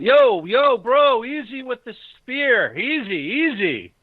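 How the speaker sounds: high-pitched, drawn-out alarmed yell; pleading yell from the bushes. Acted. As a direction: call out high to calm down someone armed. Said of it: "A voice yells out".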